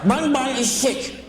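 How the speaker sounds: high-pitched mock customer; crazy woman's voice. Crazy woman returns rabbit to pet shop, high mock voice. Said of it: "this woman talked like this".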